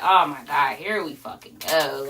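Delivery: tired low mutter of here we go again; a drained, resigned groan under the breath; weary sigh. Retold or imitated. Wife groaning at husband starting a fight again.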